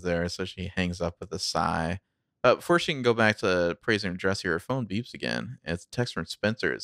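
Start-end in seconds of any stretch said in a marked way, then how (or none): none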